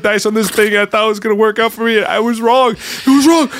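loud, high despairing shout; angry rant. Acted. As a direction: shout it out, near breaking. Priest ranting at the bar.

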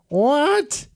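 very high, loud shocked witch voice; shocked witches. A narrator's reading. Witches seeing their spell broken, shocked witch voice. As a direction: sputter in disbelief. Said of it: "The witches said".

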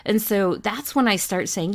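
normal talking voice

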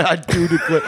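laughing imitation of a Southern accent; deep Southern accent. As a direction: echo a Southern belle's exclamation in dialect. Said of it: "mix of a deep Southern accent and French".

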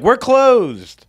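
high, loud sing-out; mimicked staff call. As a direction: call it out like a restaurant greeting. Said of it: "But it sounds like".

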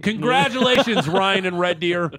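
high, loud prize announcement; announcer voice. Acted. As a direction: announce the winner with fanfare. Announcing the contest winner.

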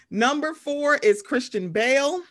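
laughing. Real, not acted. Reading out the Batman list, laughing. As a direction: giggle through the next name.

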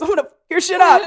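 loud, fast, high taunt; mock threat. Heartbreak taunting its next victim, loud mock threat. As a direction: rattle off a playful threat, half laughing.

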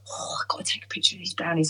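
fast, excited whisper; hushed, eager whisper; whispered to self. Retold or imitated. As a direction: sneak the thought out under the breath. Retold inner voice whispering.

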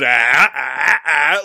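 loud, grunting wild Muppet pant; hammed-up primitive lecherous shouts; Animal. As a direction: bellow like a crazed puppet monster. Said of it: "like fucking Animal from the Muppets".

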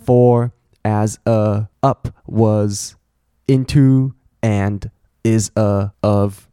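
slow, disconnected grammar words; function words only. Shown as a demonstration. Teacher leaves only grammar words, slow and disjointed. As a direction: drag out each little word with gaps. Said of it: "好，我只留介系词，".

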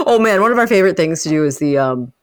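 ordinary talk, nothing marked

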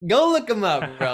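playful shout, high and loud; loud jokey retort. Real, not acted. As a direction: shoot it back loud and teasing. Host jokingly fires back at guest.

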